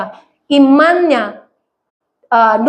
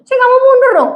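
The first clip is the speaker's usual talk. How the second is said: high, louder stubborn refusal; indignant; 10 semitones higher, 4 dB louder, faster than usual. Retold or imitated. Preacher refusing the parking attendant, high and indignant. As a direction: dig in your heels, indignant and high.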